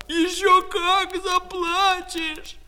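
very high, slow, loud yell; high-pitched angry cry; shouted threat. Acted. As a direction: hurl the threat back, stretching each word. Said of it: "кричала Катя".

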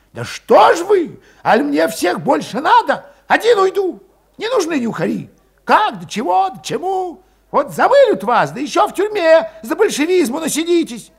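high, loud, scornful outburst; Valet's tirade. A narrator's reading. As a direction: lash out at the cowards.

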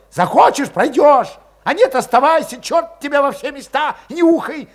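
high, loud snarl at the others; snapping angrily. A narrator's reading. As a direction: bite their heads off. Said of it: "окрысился вдруг Валет".

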